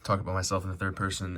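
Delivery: usual speaking voice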